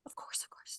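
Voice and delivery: hushed whispered aside. Real, not acted. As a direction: drop to a breathy whisper.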